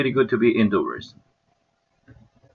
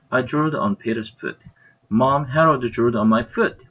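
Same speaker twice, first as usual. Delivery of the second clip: ordinary delivery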